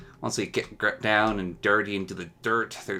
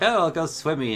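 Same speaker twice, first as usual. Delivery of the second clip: mock carefree; 8 semitones higher than usual. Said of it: "You're like".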